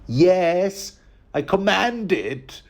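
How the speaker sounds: high, slow petulant command; angry Joffrey. Retold or imitated. The furious boy king commanding his uncle, high. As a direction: shriek it like a spoiled king. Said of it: "Joffrey immediately loses it".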